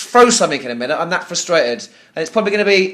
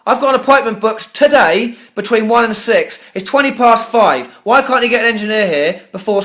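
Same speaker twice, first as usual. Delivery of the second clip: loud, higher, hammering each why; furious shout; 3 semitones higher, 4 dB louder than usual. Real, not acted. Angry customer demanding an engineer from the agent, loud. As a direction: yell the questions at him, exasperated.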